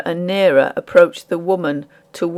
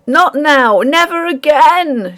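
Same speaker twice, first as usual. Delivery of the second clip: high, loud, slowing on never again; the miller shrieking back at the taunting ghost; frantic shriek; 8 semitones higher, 5 dB louder, slower than usual. A narrator's reading. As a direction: refuse it in horror. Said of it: "shrieked the miller".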